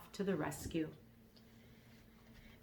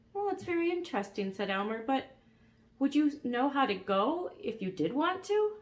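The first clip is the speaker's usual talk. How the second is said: put-on quick boy voice; 7 semitones higher than usual. Elmer voiced in story.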